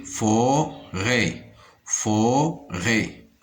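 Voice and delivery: FO-RÊT in two separate beats; Forêt with a gap between syllables; split into syllables. Shown as a demonstration. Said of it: "dans la première syllabe".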